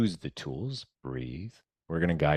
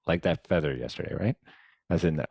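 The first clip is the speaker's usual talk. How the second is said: ordinary talk, nothing marked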